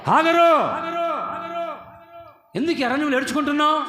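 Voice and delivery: high, loud call across the desert, then quick. Acted. God calling out to Hagar in the wilderness, loud and high. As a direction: call her name out high and loud, then ask fast.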